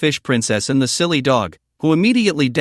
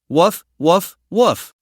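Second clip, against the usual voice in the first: playful barks, rising on the last; dog barking; 3 semitones higher, much slower than usual. Acted. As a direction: bark like an eager dog. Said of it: "The silly dog ... also barked along".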